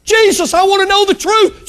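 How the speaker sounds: high, urgent cry; calling out. Retold or imitated. Seekers to Jesus, high-pitched calling out. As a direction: call out high, seeking an answer. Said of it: "people that are calling out".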